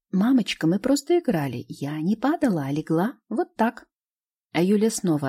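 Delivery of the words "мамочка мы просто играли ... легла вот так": put-on child voice. Child explains, playful.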